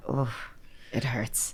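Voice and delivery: breathy hurt under the breath; a hurt sigh of a line, voiceless; pained whisper. Retold or imitated. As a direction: whisper it like it still stings. Retold moment of hurt.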